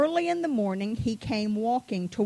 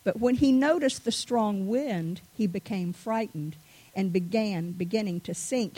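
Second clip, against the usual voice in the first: usual speaking voice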